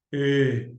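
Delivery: "E" as a lone, drawn-out closed ê. Shown as a demonstration. Teacher isolating a vowel, "E" held as closed ê. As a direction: isolate the closed ê sound of "E" and hold it. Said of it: "O E fica fechado".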